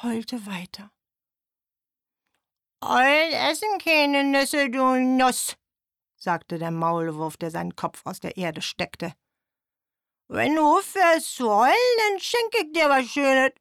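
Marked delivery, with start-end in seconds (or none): 2.8-5.6 higher, louder cartoon mole; a mole poking fun at the squirrel. 10.3-13.5 higher, louder cartoon mole; a mole poking fun at the squirrel. Mole coaxes the owl.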